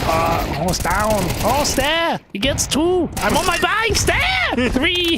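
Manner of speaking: high-pitched hammed-up caster voice; pimp impression. Caster doing a pimp impression for chat, high-pitched hammed-up. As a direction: squeal the call way up high. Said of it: "I can do, like, a pimp impression".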